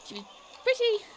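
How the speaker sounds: high, jittery voice; scared informant under intimidation; frightened informant. Acted. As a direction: stammer it out, terrified. Voices nervous informant at length.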